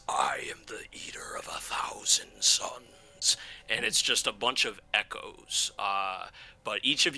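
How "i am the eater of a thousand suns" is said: slow whispered guttural boast. Acted. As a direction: breathe it out slow and ominous. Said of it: "It says".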